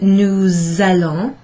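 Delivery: "nous allons" slow, the S said as a Z; teacher stretching out the liaison; liaison demo. Shown as a demonstration. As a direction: join "nous allons" into one word with a Z sound. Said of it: "is to pronounce that S".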